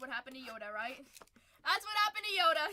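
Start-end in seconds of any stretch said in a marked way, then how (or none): 1.6-2.7 loud, high exclamation; mock outcry. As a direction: cry it out much higher and louder. Jokingly exclaims a repeated line.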